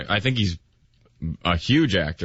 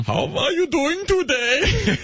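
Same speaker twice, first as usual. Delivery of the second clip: very high, slow mock German accent; reluctantly trot out a squeaky German accent; slower than usual. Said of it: "Do your German accent for her".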